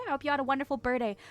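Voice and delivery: gabbled very fast, "birthday" slurred; rushed. Real, not acted. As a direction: rattle the wish off too fast to land it. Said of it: "Talk correctly, May".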